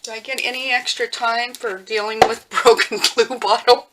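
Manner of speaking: half-laugh through the question. Real, not acted. Laughs at broken glue bottle.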